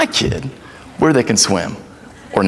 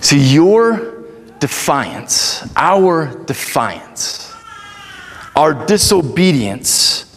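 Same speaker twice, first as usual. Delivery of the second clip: slow preaching; 4 semitones lower, slower than usual. Acted.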